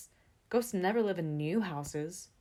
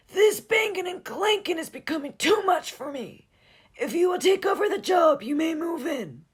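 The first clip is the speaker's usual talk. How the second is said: ghostly groan; 11 semitones higher, 12 dB louder than usual. Acted. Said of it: "he groaned in his ghostly manner".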